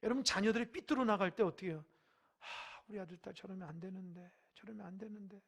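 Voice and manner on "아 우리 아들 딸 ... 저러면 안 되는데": softer, fretting parent muttering to themselves; worried parent. Retold or imitated. Parent fretting over a wayward child, softly. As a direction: sigh it under the breath like a parent watching a child go wrong. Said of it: "그러잖아요".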